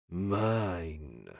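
low, quiet, drawn-out possessive growl; wolf's growl. A narrator's reading. Wolf claiming his mate in the narrator's head, growled. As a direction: growl it softly, like an inner wolf. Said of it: "my wolf growled in my head".

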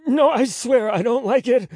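high, loud frantic plea; panicked, high-pitched denial; desperate pleading. A narrator's reading. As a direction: plead with him to believe you. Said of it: "Roman pleaded".

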